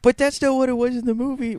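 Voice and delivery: high, loud Foxworthy impression; Jeff Foxworthy. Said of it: "Did you just do Jeff Foxworthy?".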